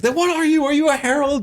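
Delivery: high-pitched mock incredulity; exasperated questioning. Dalinar questioning the Stormfather, acted exasperation.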